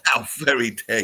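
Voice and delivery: playful scandalised protest; mock outrage. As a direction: join in the pretend offence. Echoes the mock offence.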